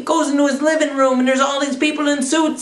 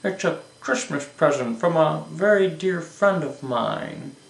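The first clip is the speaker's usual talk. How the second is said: low, softer character voice; George Bailey; 6 semitones lower, 5 dB quieter than usual. Acted. George explains the book he picked up, low softer voice. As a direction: answer quietly, dropping low. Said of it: "George picked up a book".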